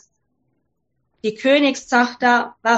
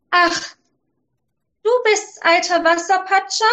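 put-on higher, girlish princess voice; 7 semitones higher than usual. Princess greets the frog in the well, higher girlish voice. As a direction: sound like a pouting young princess. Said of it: "sagte sie".

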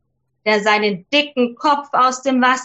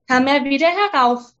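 ordinary delivery, nothing marked

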